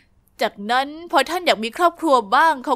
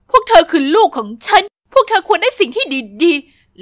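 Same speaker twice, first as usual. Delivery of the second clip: slow insistent plea; 2 semitones higher, 5 dB louder than usual. Acted. Mother insists desperately.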